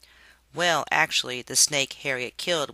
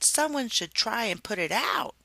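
fretful; 8 semitones higher than usual. Acted. Harriet voiced after lament.

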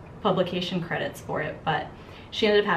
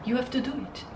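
put-on French Canadian accent; 6 semitones higher, 5 dB quieter than usual. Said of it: "regal in her French Canadian accent".

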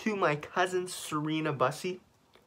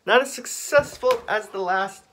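mock dismay; 6 semitones higher, 5 dB louder, slower than usual. Loud mock complaint.

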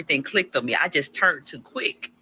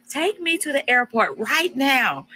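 bossy demand; 5 semitones higher than usual. Retold or imitated. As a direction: boss him like she's home. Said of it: "Acting like she's back in the U S".

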